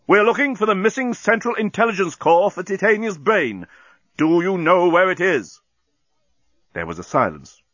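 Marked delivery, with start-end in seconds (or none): 0.1-5.6 high, loud, insistent captain; yelling. A narrator's reading. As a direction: shout the question to a distant listener. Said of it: "Bolfas yelled into the intercom".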